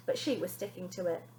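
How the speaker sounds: ordinary delivery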